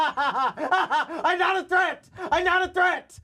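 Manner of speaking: very high, slow, laughing through the words; laughing falsetto. Retold or imitated. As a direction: crack up mid-line. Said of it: "It's like".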